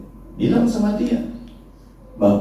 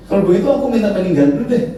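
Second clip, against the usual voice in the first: cheeky request; 8 semitones higher, 3 dB louder, faster than usual. Retold or imitated. Idris's request.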